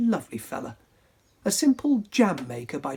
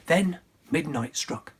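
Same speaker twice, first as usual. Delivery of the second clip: ordinary delivery, nothing marked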